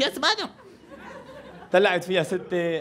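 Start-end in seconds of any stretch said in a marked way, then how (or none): 0.0-0.5 high, soft polite reply; policeman voice. Retold or imitated. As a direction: answer like a dutiful English cop. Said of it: "اجت البوليس". (صوت شرطي مهذب)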